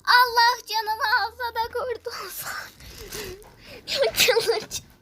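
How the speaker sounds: high, loud exasperated wail with a laugh; loud frustrated lament; despairing outcry. Real, not acted. Frustrated gamer losing.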